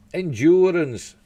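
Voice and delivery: slow, loud and high; dramatic announcement. Real, not acted. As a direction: announce it like a big reveal. Announcing the next news item.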